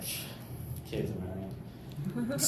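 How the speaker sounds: a shushing neighbor, lightly voiced; neighbor hushing. Comic imitating the neighbor, softly hushing. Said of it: "the neighbor's just like".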